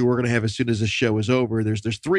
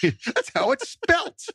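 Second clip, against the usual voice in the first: laughing; much slower than usual. Real, not acted. Host laughing at the name.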